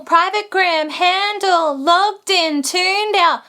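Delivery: spoken lyric. Shown as a demonstration. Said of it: "say the words instead of sing them".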